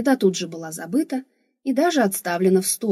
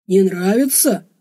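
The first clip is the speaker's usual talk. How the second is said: louder, slow, caring barbarian voice; solicitous barbarian; 6 dB louder than usual. A narrator's reading. Barbarian checks whether she likes the food, solicitous. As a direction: ask with slow, hearty concern. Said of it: "заботливо спросил варвар".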